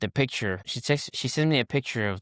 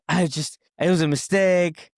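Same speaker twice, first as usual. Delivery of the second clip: retold bawling; 7 semitones higher, 5 dB louder than usual. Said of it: "bawling my eyes out".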